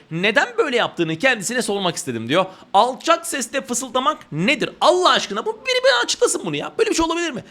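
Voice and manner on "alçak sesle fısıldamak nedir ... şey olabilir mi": pitched way up in mock outrage; incredulous teacher asking the class; exasperated disbelief. Real, not acted. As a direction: throw up your hands, someone explain this. Mocking a redundant phrase.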